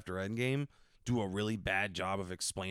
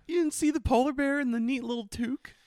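mock incredulous; 5 dB louder than usual. Real, not acted. Mocking question.

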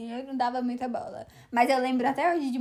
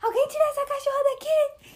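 much higher, exasperated yell at the dog; dad interrupted in a meeting, yelling at the dog; irritated dad yelling; 3 dB louder than usual. Retold or imitated. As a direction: imitate dad snapping at the barking dog. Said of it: "meu pai fica puto com ela".

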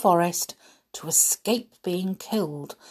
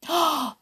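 high, excited intake of breath; delighted gasp; 10 semitones higher than usual. Acted. The dwarves hear about the pie, delighted gasp. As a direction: gasp with glee.

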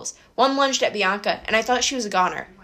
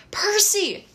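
scream; 11 semitones higher than usual. A narrator's reading. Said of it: "Talia screamed".

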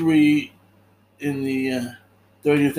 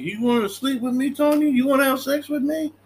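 higher, taunting voice of Tony's armed sister; sister with a gun; 10 semitones higher, faster than usual. Retold or imitated.

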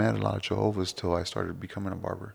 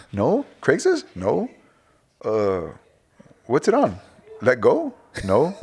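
higher, louder teasing questions; mock interrogation; 8 semitones higher, 5 dB louder than usual. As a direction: grill the caller playfully. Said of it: "I'll be like".

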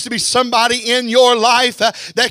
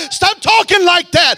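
higher, louder shouted repeat; preaching shout; 7 semitones higher, 5 dB louder than usual. Acted. Preacher repeating his command to the church, shouting. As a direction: hit it harder the second time.

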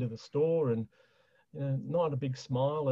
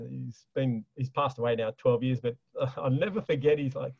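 normal talking voice

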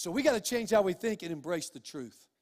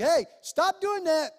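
mock stern call, louder and higher; slow, raised warning; God calling out; 7 semitones higher, 6 dB louder, slower than usual. God warning his children with a loud, slow "Hey". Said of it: "He'll first tell you".

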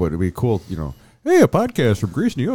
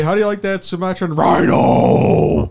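monster truck ad; 9 semitones higher, 5 dB louder, slower than usual. Retold or imitated. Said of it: "the monster truck guy".

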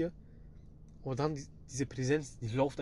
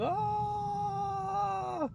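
very high short scream; imitated scream. A family member screams upstairs, very high shriek. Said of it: "ich höre nur so einen Schrei".

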